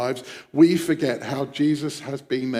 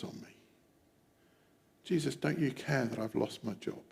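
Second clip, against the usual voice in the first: soft, inward troubled prayer; private plea; 3 semitones lower, 6 dB quieter than usual. Acted. Struggling believers asking Jesus about their storms, quiet private plea. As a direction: voice it quietly, as a thought to oneself. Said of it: "or thought to ourselves".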